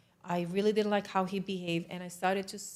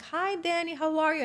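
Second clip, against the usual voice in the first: high, friendly sing-out hello; chirpy greeting; 8 semitones higher, 4 dB louder than usual. Retold or imitated. Soldier greets the visiting commander, high and chirpy. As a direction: greet them in a raised, sociable voice.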